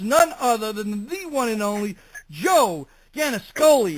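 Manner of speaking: ordinary talk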